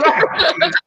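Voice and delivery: high startled cry of surprise; shocked yelp. Retold or imitated. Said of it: "We're going".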